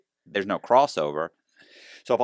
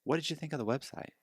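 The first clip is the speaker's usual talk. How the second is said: soft, higher leading question; gentle probing question; 6 semitones higher, 12 dB quieter than usual. Retold or imitated. Teller leading the agent on, softly probing. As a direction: ask it delicately, fishing for a reaction.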